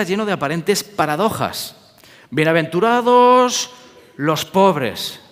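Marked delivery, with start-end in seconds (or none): 2.3-5.2 slow, raised proclamation; solemn scripture quote. Acted. Quoting beatitudes solemnly. (proclamación solemne y lenta)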